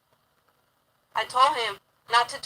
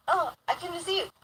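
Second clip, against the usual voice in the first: higher, softer mock innocence; imitating unwanted visitor; 5 semitones higher, 6 dB quieter than usual. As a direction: play him coyly innocent. Voices the unwanted man's reply.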